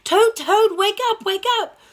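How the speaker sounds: high, loud eager calling; excited Frog. Acted. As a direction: call your sleepy friend awake, thrilled. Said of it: "He was like".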